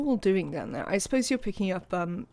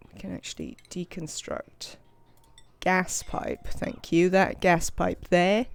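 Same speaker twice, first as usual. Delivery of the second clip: ordinary talk, nothing marked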